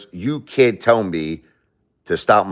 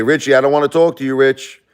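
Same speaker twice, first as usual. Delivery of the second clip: voicing Jesus; 7 semitones higher, 5 dB louder than usual. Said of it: "You say".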